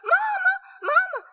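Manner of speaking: calling for mother at a high, loud shriek; desperate high loud calls for help; frantic hollering. Acted. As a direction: yell for Mama in terror. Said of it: "She began to holler".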